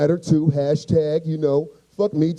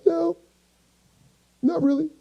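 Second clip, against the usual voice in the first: high, tearful little denial; mock crying; 11 semitones higher than usual. Hurt man answering his nosy friend, high mock-tearful voice. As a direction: answer as if holding back sobs. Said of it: "First off, stop crying".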